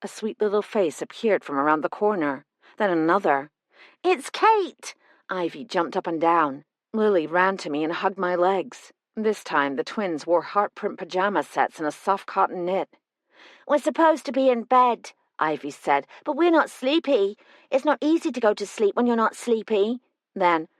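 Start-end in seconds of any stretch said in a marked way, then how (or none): 4.0-4.9 put-on high, delighted little girl's cry; gleeful child spotting a friend; excited child. Child twin greets Kate gleefully. 13.7-15.1 put-on lightly raised child voice; a chatty small girl confiding; a hint of a young twin; little girl. Twin Ivy speaks. 16.2-20.0 put-on lightly raised child voice; a chatty small girl confiding; a hint of a young twin; little girl. Twin Ivy speaks.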